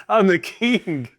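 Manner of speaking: slow, drawn-out gloating; mock boast. Podcaster brags to cohosts, slow mock-royal gloat. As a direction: crown yourself king, savoring it. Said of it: "Dude, I brought the Houston five hundred".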